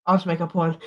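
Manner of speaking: usual speaking voice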